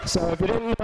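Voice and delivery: laughing. Real, not acted. Joking about bad review.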